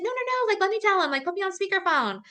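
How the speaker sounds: very high rushed no no no; excited urgent. Retold or imitated. Daughter wanting to tell dad herself, high eager insisting. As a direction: stop her eagerly, voice up high.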